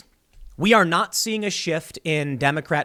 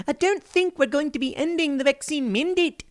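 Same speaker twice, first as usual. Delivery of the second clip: put-on a weak, half-hearted New Zealand accent, pitched higher; failed New Zealand accent; 10 semitones higher than usual. Quoting the New Zealand Prime Minister in a poor New Zealand accent. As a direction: try a New Zealand accent and fall short. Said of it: "I can't really do a New Zealand accent".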